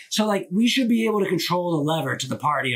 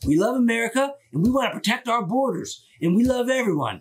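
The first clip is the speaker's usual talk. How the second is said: lightly put-on MAGA-guy accent, pitched up; mock MAGA accent; 6 semitones higher than usual. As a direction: playfully imitate a MAGA supporter's accent. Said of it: "maybe they do have that accent".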